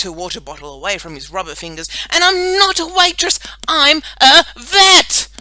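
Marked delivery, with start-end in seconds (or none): 2.1-5.3 high yell slowing to word-by-word emphasis; furious correction. A narrator's reading. Girl storms off from boy superhero, furious. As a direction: shout it slowly at the end.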